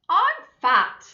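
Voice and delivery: high, loud, put-on mummy elephant voice; mother's high voice. Mummy elephant to her children, high and loud. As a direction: squeak out the mother's line.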